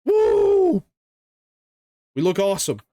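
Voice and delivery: loud high whoop of joy; excited cheer. Real, not acted. Cheering after getting the sword.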